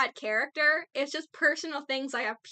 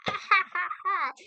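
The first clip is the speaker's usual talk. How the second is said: mock laugh; 7 semitones higher than usual. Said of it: "and it's like".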